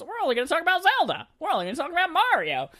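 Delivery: very high-pitched cartoonish company voice; Nintendo. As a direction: squeak it out in a silly high voice. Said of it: "why Nintendo has that voice now".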